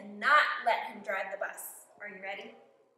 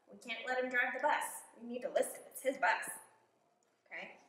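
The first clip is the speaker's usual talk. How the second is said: plain reading voice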